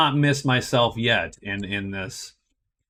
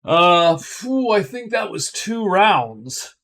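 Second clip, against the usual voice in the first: higher, slower voice, exhaling with relief; relieved phew; 6 semitones higher, slower than usual. Real, not acted. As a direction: let the tension go with a phew. Relief after a tense round.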